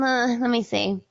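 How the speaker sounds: ordinary delivery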